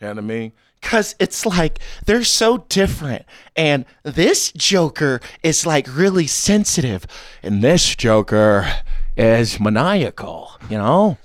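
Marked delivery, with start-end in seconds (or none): 0.8-7.0 higher, sensitive villain voice; Joaquin Phoenix's Joker. Acted. Comedian contrasts two Jokers, as the sensitive one. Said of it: "Joaquin Phoenix in the Jo". 7.4-11.2 slow, maniacal villain voice; Heath Ledger's Joker. Acted. Comedian contrasts two Jokers, as the maniacal one. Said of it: "talking to Heath Ledger's Joker".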